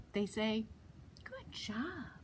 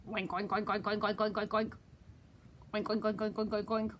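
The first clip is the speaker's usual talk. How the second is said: pig oinks. Shown as a demonstration. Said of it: "what do they say".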